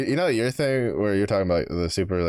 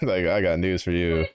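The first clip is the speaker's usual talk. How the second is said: normal talking voice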